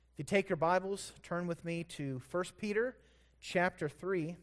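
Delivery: ordinary talk, nothing marked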